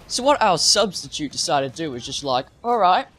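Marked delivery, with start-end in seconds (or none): 2.6-3.1 a quick, higher mock British "All right"; brief imitation of a British teacher saying "All right"; British accent. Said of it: "Actually, she's got a British accent".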